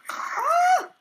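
short high-pitched shriek; sudden loud, high scream of fright; startled scream. Real, not acted. Player attacked by zombies, startled scream.

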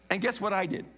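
usual speaking voice

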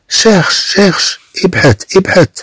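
high, urging call to a dog. Acted. Teacher voices the master calling dog. (مناداة الكلب بصوت مرتفع النبرة)

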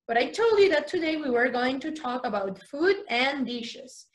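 ordinary talk

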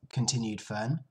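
usual speaking voice

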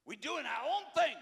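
high, loud mock boast; loud sarcastic cry; mocking shout. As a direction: squawk it out in mockery. Mocking his generation's slogan.

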